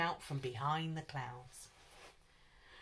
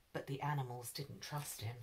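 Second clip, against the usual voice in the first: ordinary delivery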